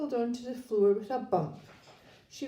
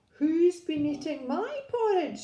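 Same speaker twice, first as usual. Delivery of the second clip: higher-pitched Mummy Bear; middle-sized voice; 7 semitones higher, 3 dB louder than usual. A narrator's reading. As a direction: ask it high, as the storybook mother bear. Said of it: "she said in her middle-sized voice".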